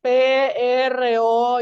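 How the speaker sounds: mock dictation, letter by letter; spelling letter by letter. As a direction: drag out every letter like dictation. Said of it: "letra por letra".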